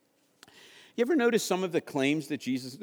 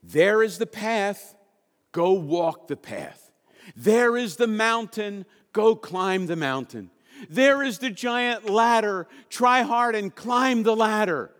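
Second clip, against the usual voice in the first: slow, louder, grand command; other religions' voice; 4 semitones higher, 4 dB louder, slower than usual. Acted. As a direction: preach like a stern guide, slowly. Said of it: "Every other religion says".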